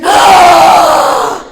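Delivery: put-on very high, loud squealing kid voice; ecstatic child shriek. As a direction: shriek with joy like a thrilled girl. Child screams with joy at gift.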